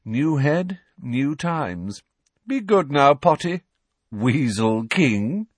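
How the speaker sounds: lightly voiced mocking bully; taunting Malfoy. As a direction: toss the taunts over his shoulder.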